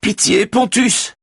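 extremely high, drawn-out plea; acted frantic appeal; desperate cry. A narrator's reading. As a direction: wail for mercy at the top of the range. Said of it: "s'écrie-t-elle".

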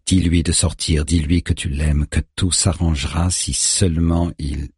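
usual speaking voice

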